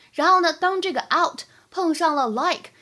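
normal talking voice

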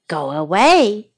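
ordinary delivery, nothing marked

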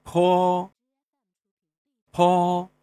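坡 held long on a high level pitch, loud; demonstrated initial sound. Shown as a demonstration. Teacher showing learners the initial, demonstrating 坡 high and level. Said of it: "声母".

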